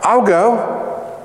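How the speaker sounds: high, drawn-out volunteer's call; mock-eager cry of a volunteer. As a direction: call out eagerly, pitch lifted, without shouting. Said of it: "So he cries out".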